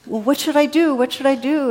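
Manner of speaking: high and loud, begging for an answer; the hiker pleads with God, high, loud and desperate. Retold or imitated. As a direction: plead like a man about to fall. Dangling man begs God.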